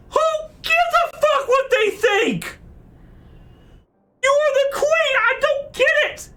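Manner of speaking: high-pitched shouting in disbelief; outraged rant. Real, not acted. Player berating the queen for giving in, outraged.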